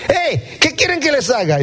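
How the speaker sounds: high-pitched mock holler; shouting from afar. Jesus shouting to the blind men from afar, calling out. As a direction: call across a distance, pitched high. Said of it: "les gritó desde allá".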